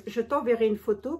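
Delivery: ordinary delivery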